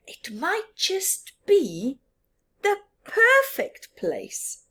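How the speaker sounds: high, slow hopeful whisper. Acted. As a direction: hush it out hopefully.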